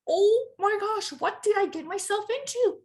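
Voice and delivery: high, dramatic panic; retold freak-out. Runner realizing the race distance, mock panicked. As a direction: act out dawning alarm, pitch way up. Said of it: "I literally started freaking out".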